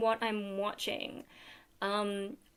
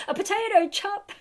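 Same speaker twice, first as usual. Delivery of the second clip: put-on New Zealand accent; 9 semitones higher, 3 dB louder than usual. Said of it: "fish and chops".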